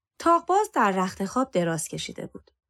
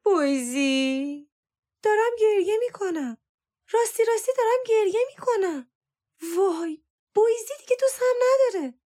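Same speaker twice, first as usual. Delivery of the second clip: moaning wail; 13 semitones higher than usual. A narrator's reading. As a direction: wail for attention, voice held high. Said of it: "سارا بار دیگر نالید".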